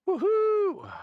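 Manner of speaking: excited whoop. Real, not acted. Computer finally works.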